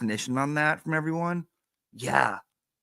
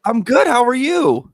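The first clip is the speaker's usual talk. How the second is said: high, loud happy hello; excited greeting; 12 semitones higher, 10 dB louder, slower than usual. Real, not acted. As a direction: greet a dear friend with a big burst. Greeting a caller happily.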